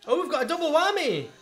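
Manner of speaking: high, loud burst of delight; real excitement at a surprise reveal; excited squeal. Real, not acted. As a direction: shout it out in thrilled surprise. Excited reaction to twins reveal.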